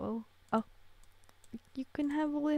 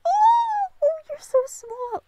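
squealing coo; 12 dB louder, slower than usual. Real, not acted. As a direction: squeal with delight, very loud and high. Cooing over tiny infants.

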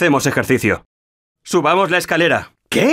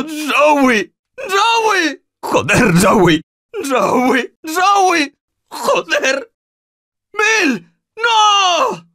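high anguished cries; grief-stricken screaming; 10 semitones higher, much slower than usual. Acted. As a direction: wail their names in despair. Zoe and Bill die.

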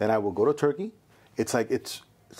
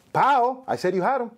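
Gleason insisting; 8 semitones higher than usual. Retold or imitated. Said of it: "He's like".

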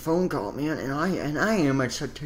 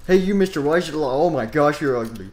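mock yell; 3 semitones higher, 8 dB louder than usual. Yelling at locked door's owner.

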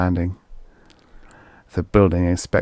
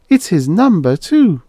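much higher and louder, amazed; wide-eyed surprise; 10 semitones higher, 6 dB louder than usual. A narrator's reading. The mayor's wife noticing the plate number, high amazed and louder. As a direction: squeak it out, amazed.

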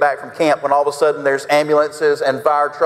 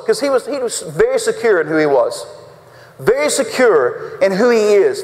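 usual speaking voice

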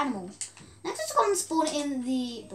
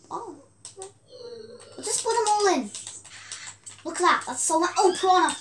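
ordinary talk, nothing marked

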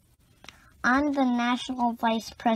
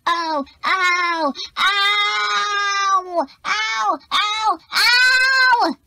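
rising yelps of pain, louder and higher each time; cries of pain; 10 semitones higher, 9 dB louder, much slower than usual. Acted. Kid hurt in class, yelping ow in rising pain. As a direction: yelp ow over and over as it hurts more.

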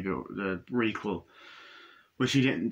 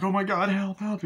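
high imitated cry for help; mock panic; 8 semitones higher than usual. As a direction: call for help in pretend panic. Daughter flees in retold scene.

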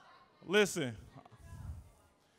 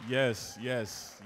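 ordinary talk, nothing marked

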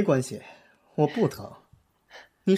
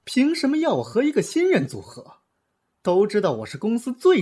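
higher, indignant demand; indignant protest; 8 semitones higher, 3 dB louder than usual. Acted. Star indignantly protests the pairing to his agent. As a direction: push back hard, offended.

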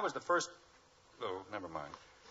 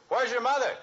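loud, higher call; 12 semitones higher, 8 dB louder than usual. Acted. Father calling to his daughter in the living room, loud call. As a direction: shout the question across the house.

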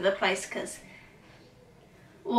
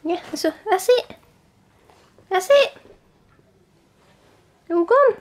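much higher, loud sing-song praise; high dog praise; 10 semitones higher, 8 dB louder than usual. Real, not acted. Praising the dog after the biscuit, high and loud.

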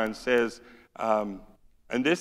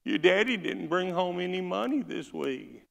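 raised-pitch whine; whining mama; 7 semitones higher than usual.

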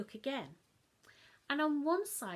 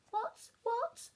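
higher feigned innocence; innocent child; 13 semitones higher, 4 dB louder than usual. Acted. Child to Mum, higher, innocent. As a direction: play dumb.

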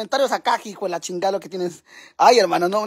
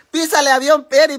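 ordinary talk

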